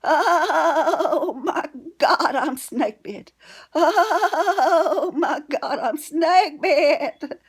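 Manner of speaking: high, loud frightened shriek, half laughing while retelling; a startled old granny shrieking in alarm; panicked scream. Retold or imitated. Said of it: "She screamed".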